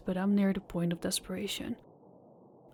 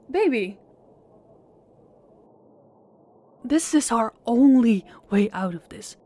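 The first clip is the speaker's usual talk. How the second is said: louder, pressing; raised voice pleading with his girlfriend; urgent plea; 3 semitones higher, 7 dB louder than usual. Acted. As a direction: insist over her protest.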